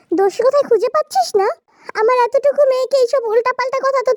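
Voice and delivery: high-pitched, indignant family row; quarrelling mothers. Acted. Two mothers blaming each other's children, high and indignant.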